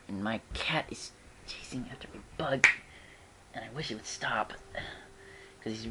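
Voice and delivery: soft, breathy aside while recording; whispered aside. Real, not acted. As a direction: hush it as if not to disturb. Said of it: "aside about her cat".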